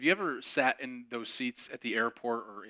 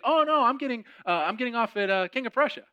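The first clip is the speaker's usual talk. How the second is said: much higher, bright imitation of a cheerful stranger; chirpy passenger; 9 semitones higher, 4 dB louder than usual. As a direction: mimic her sing-song chatter. Imitating the woman on the bus.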